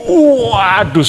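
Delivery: high, drawn-out mock cry of pain; retold pained yell. Husband re-enacts crying out over his hurt hand, loud wail. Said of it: "saya teriak".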